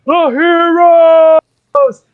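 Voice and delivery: high, loud, drawn-out rallying cheer; whole bar cheering. Acted. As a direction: shout a long toast like a tavern crowd. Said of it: "you hear the whole bar go".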